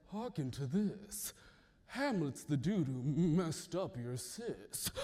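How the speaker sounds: a hint of a conniving king; scheming king.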